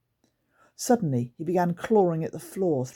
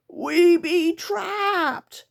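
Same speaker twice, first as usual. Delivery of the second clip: very slow, much higher, louder creature squeal; 14 semitones higher, 6 dB louder, much slower than usual. Acted. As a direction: stretch the squeal out in panic. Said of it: "squealed Sniff".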